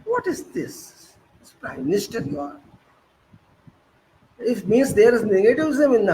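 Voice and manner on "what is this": incredulous scoff. Real, not acted. Mocking disbelief at statement.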